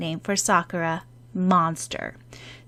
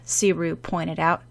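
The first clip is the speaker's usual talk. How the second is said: ordinary delivery, nothing marked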